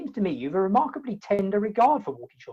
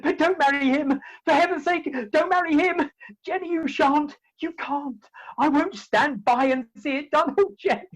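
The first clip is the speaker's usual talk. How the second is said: passionate plea; 4 semitones higher than usual. Acted. Suitor begging Jenny not to marry, impassioned plea. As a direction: beg her not to marry him.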